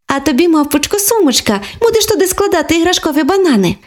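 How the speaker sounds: put-on high, childlike, sincere apology; little girls saying sorry to toys; earnest young girl voice; girls' voice.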